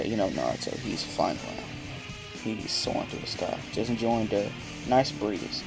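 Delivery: ordinary delivery, nothing marked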